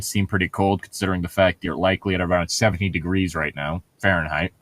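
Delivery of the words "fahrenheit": ordinary delivery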